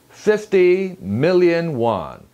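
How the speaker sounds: "million" articulated clearly in "fifty million won"; distinct MILLION. Shown as a demonstration. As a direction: sound out "million" crisply. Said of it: "million 또렷하게 발음하시고요".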